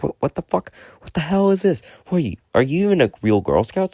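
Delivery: high-pitched indignant questioning, retold; outraged disbelief. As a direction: act out incredulous outrage, pitch shooting up. Outraged at Girl Scouts' delay.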